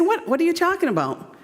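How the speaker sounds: as if the question makes no sense; puzzled mother. Retold or imitated.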